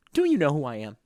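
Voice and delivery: understated, put-on challenge at normal volume; mock menace. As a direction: deliver a restrained mock threat. Acted confrontation, first quiet take.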